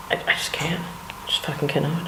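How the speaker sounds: whispered, at a loss for words; exasperated whisper. Real, not acted. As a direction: breathe it out as if words fail you. Disgusted about Trump supporters.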